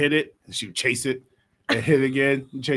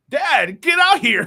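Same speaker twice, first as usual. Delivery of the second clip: coach calling out; 9 semitones higher, 7 dB louder, slower than usual. Retold or imitated. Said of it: "he looks at me and he goes".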